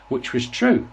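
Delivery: ordinary delivery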